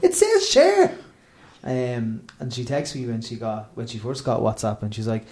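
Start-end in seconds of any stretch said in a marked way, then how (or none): 0.0-0.9 high, animated retold voice; high-pitched comic back-and-forth; mock exchange with aunt. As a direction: act out the phone call, pitched well up. Acting out aunt on Facebook call.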